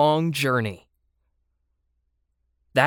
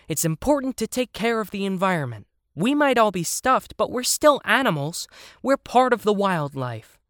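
high, rallying cry, a little louder; mild shouting; 8 semitones higher, 4 dB louder than usual. A narrator's reading. Toys argue for the environment, mildly shouted in high voices. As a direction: call it out high, short of a full shout. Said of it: "everyone started shouting".

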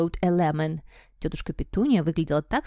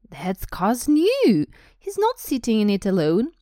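loud, high indignant outburst; acted indignant protest; indignant objection; 5 semitones higher, 5 dB louder than usual. A narrator's reading. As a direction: object loudly, voice raised. Uncle objects about his car.

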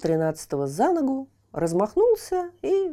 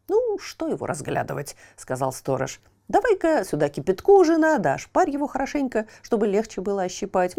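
greedy watchman. Acted. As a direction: order the boiling water, pleased with the free meal. Watchman orders wife.

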